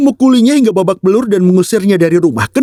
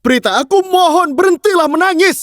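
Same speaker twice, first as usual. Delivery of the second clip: pleading; 9 semitones higher than usual. Acted. Begging crying girl to stop.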